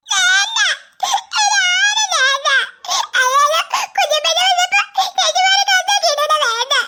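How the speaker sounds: put-on little boy crying because nobody wants him; crying child. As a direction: whimper it like a hurt toddler. Child crying, feeling unwanted.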